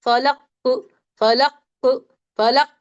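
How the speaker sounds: फलक ending in a strong but not doubled bounced क; one-and-a-half sound. Shown as a demonstration. Teacher drills the end-of-word qalqala for students. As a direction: bounce the final क of फलक, half again long. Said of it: "डेढ़ sound".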